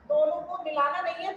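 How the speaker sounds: ordinary delivery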